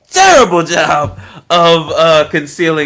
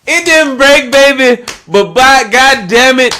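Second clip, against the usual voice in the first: higher and louder, laughing through it; laughing while talking; 7 semitones higher, 5 dB louder than usual. Real, not acted. Mocking the actress's slip, laughing loudly throughout. Joking about the accent slip.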